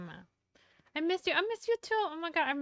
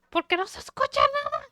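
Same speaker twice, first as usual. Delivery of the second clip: frustrated loud; 7 semitones higher, 10 dB louder, much faster than usual. Real, not acted.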